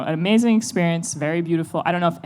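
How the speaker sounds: ordinary delivery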